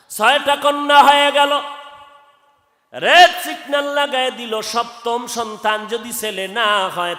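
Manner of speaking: high, loud chanted storytelling; full-force preaching. Real, not acted. Preacher resuming the husband's warning, high loud chant. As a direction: call it out like an alarm.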